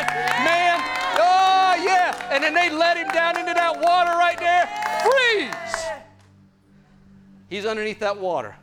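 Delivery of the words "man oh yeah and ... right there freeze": excited shout. Acted. Excited baptism, then freeze.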